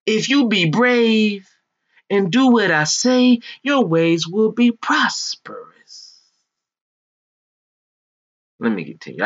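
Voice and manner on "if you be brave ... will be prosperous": slow, resonant preacher voice. Acted. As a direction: draw it out like a sermon. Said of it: "I try to get in my preacher voice".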